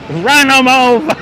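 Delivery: loud, drawn-out joking holler; mock battle yell. Streamer joking with viewers about traffic, mock battle yell. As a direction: holler it high and long, as a joke.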